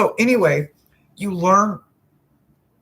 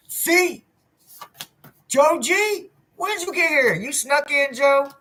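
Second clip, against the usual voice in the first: high, bright, playful welcome; teasing high-pitched hello; excited high greeting; 12 semitones higher than usual. Real, not acted. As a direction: call out to latecomers.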